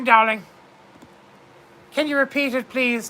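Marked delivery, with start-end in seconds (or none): none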